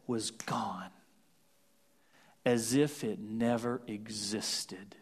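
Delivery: hushed whisper, slowing; whispered awe. Acted. Preacher whispering to the congregation that sin is gone, hushed. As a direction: breathe it out in wonder.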